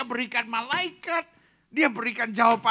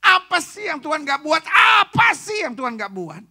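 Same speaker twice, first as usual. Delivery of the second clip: rousing shout; 3 semitones higher, 10 dB louder than usual. Acted. Preacher rousing congregation.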